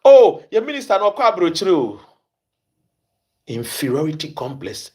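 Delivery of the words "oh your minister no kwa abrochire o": foolish radio boast. Retold or imitated. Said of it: "they will say it foolishly on radio".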